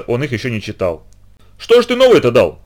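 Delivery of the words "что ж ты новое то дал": indignant, high and louder; возмущение. A narrator's reading. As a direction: protest indignantly in a raised voice. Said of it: "возмутился я". (возмущённо, на повышенных тонах)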